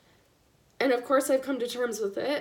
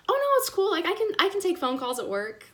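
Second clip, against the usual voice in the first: high, loud chirpy brush-off; breezily insisting it's fine; mock breezy reassurance; 7 semitones higher, 5 dB louder than usual. Mother breezily tells the geneticist she can take calls, high and chirpy.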